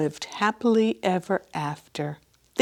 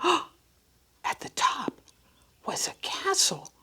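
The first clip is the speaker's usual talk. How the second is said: suspenseful whisper; 4 semitones higher than usual. A narrator's reading. Hushed suspense narration.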